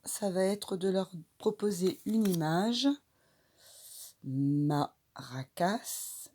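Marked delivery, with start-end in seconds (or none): 4.2-6.3 very slow, syllable by syllable Maracas; slow segmented Maracas; syllables drawn out. Shown as a demonstration. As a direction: stretch out Maracas so each syllable shows. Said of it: "qu'est-ce qu'on entend comme syllabe dans maracas".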